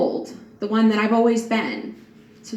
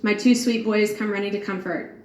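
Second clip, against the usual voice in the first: normal talking voice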